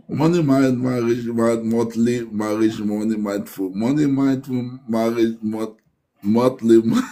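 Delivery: tongue-tied, low-pitched muddle of "monthly marriage". Real, not acted. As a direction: stumble low through the tongue twister. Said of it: "what is called with my tongue?".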